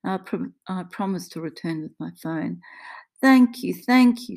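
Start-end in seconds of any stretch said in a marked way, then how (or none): 3.2-4.4 high, loud, effusive thanks; overwhelmed Italian-accented gratitude; grateful Italian woman. Acted. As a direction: gush it over and over. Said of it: "she says over and over".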